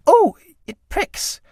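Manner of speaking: high squeal. Acted. Clytie touches stubble.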